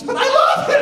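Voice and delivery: hammed-up heartbroken kid crying out; crying little girl. Teacher imitating his tearful fifth grader who misses Mister Hollis. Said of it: "And more tears".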